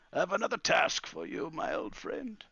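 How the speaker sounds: put-on high, creaky old master; old man gathering himself to give orders; old wizard, tearful but composing himself. Wizard giving Calamus a task after crying, composing himself.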